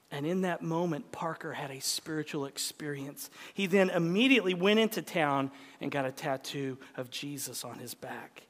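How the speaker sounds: ordinary talk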